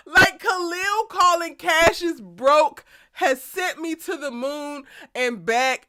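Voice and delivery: high, slow words through laughter; barely holding it together laughing; laughing while talking. Real, not acted. To viewers about Khalil's line, laughing hard.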